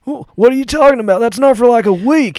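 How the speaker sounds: loud, high, flustered husband; Bill Clinton. Acted. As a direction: blurt it out in a panic.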